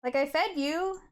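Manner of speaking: high, sing-song voice for the cat. Real, not acted. Baby talk to her cat.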